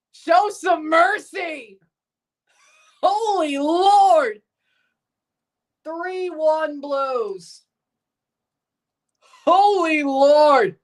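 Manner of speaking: amazed disbelief. Real, not acted. Marvelling at lopsided score.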